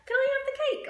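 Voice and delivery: put-on very high, loud greedy kid; a boy squeaking for cake; very high-pitched loud child. Boy asks mother.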